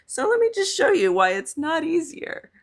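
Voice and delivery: croaky voice jumping up high; scratchy morning voice cracking upward. Real, not acted. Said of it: "I sound like a frog this morning".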